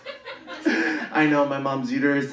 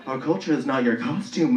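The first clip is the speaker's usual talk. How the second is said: normal talking voice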